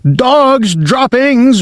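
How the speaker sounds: high, drawn-out witch screech; a pretend shriek of discovery; imagined witch yell. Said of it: "the yell of".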